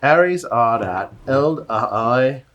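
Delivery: loud, slow mock Cockney; Cockney accent. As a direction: drop every H in a broad Cockney. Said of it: "test of his Cockney accent".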